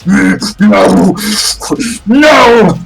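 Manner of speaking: inhuman thing screaming no; monster's rage building into a drawn-out scream; enraged scream. Acted. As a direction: howl it like a furious creature. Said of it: "it screams in rage".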